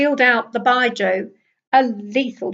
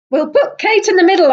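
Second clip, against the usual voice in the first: quick, high teasing joke; playful jab at a friend, raised and quick; joking; 8 semitones higher, 8 dB louder, faster than usual. A narrator's reading. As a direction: tease your friend, louder and fast. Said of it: "I joked".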